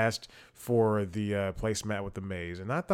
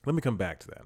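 ordinary talk, nothing marked

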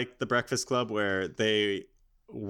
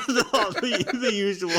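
laughing; 12 semitones higher, 4 dB louder than usual. Real, not acted. Joking about Dick Cheney.

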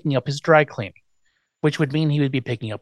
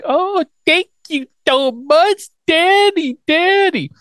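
put-on very high, slow gushing baby voice; exaggerated high babyish thanks; mock baby talk; 4 dB louder, slower than usual. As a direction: gush like a put-on little girl. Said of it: "she's baby talking".

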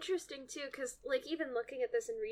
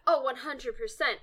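ordinary delivery

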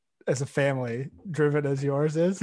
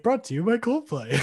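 mock ad read; 9 semitones higher than usual. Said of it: "we go to an ad break and it's like".

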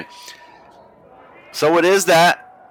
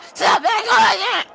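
squealing falsetto; slower than usual. Acted. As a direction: stretch it out in a shrill falsetto. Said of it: "I don't know why I sound like a smoker".

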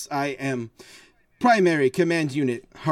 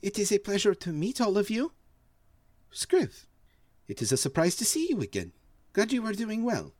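lighter, higher and quicker forge voice; a brisk, polite android lady, pitched up; Lancer's voice; 7 semitones higher, 3 dB quieter, faster than usual. Acted. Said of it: "This is Envoy Unit Lancer".

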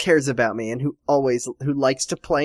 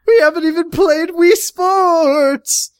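slow high fake lament; mock whining; 13 semitones higher, 5 dB louder than usual. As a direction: wail it like a baby.

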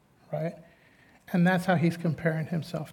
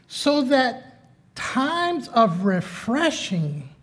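fervent preaching; 8 semitones higher, 3 dB louder than usual. Acted. Preaching builds in intensity.

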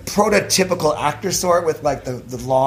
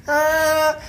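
high, drawn-out noise of reluctance; reluctant groan; 11 semitones higher than usual. Retold or imitated. Student told they were chosen, reluctant. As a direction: retell a long, high-pitched "not keen" sound.

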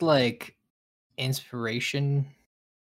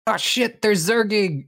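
mock shout; 7 semitones higher, 4 dB louder than usual. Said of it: "just being like".

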